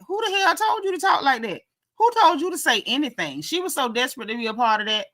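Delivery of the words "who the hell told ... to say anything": much higher, incredulous scolding; indignant telling-off. Pretend. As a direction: demand who gave her permission, pitched way up.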